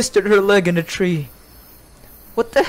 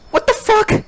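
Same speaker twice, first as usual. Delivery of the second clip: high shocked cry; 12 semitones higher than usual. Real, not acted. Dog found, shocked.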